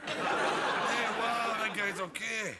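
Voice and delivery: slow, laughing hint of a stoned fan; lightly voiced spaced-out approval. Stoned audience getting the joke late, slow and lightly voiced.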